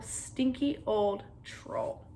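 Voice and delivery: ordinary delivery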